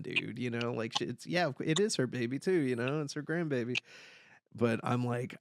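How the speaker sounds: ordinary talk, nothing marked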